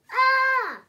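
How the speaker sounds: high, loud shriek of alarm; startled cry. Acted. Pigeon seeing the giant puppy, high alarmed cry. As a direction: yelp at the sight of the dog.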